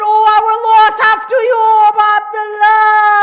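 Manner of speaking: loud lamenting cry, imitating a scream; imitated scream. Preacher translating Kulthum's lament for mourners, imitated scream. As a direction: call out the loss, stretching the name. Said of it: "This is the screams of Kulthum".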